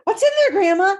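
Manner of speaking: put-on startled child. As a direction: gasp and ask in a high, startled little voice. Child startled by bag clucking.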